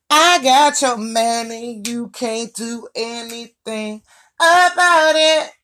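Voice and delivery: high, slow, sing-song taunt; taunting side chick. Retold or imitated. Mocking the other woman who brags she took him, high slow taunt.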